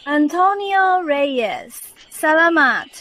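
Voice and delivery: long, slow call across distance; stretched-out, sustained call; calling out thanks. Acted. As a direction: holler the name, then the thanks. Taking a turn shouting out.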